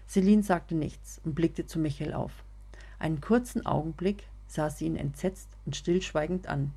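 usual speaking voice

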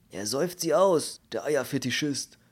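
cross, exasperated outburst; snarling. Acted. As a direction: accuse him indignantly. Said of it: "knurrte der Mann mit dem Poulet".

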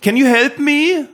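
high, slow put-on voice, English; prank asking tourist. Schoolboy pranking an English passer-by, silly high plea. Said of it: "zu Leuten gegangen sind und gesagt haben".

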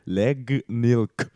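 "Legnilk" repeated clearly, leɡnɪlk; backwards speech. Shown as a demonstration. (rückwärts gesprochen: "Legnilk")

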